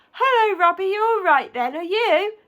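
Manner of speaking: high, loud, hearty hello; overjoyed greeting. A narrator's reading. Dad finds his voice to greet his son, overjoyed.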